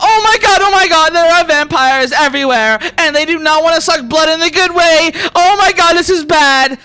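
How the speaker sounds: high, loud comic freak-out; panicked yelling. Acted. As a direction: yell the alarm as a frantic camp mate. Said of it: "Siri's yelling out".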